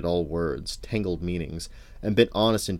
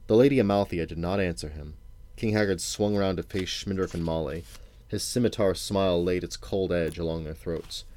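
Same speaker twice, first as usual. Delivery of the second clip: ordinary delivery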